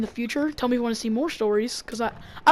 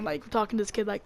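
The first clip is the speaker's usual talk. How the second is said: usual speaking voice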